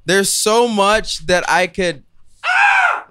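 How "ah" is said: sudden high yelp; startled yelp. Real, not acted. Sudden yelp while thinking.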